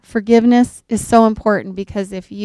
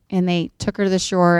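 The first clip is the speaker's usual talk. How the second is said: ordinary talk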